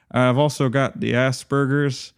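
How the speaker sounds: put-on slow, lightly done Southern accent; Shoestring's Southern accent. Hobo YouTuber explaining his diagnosis, slow Southern accent. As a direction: drawl it out gently, Southern style. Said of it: "in his very distinctive accent".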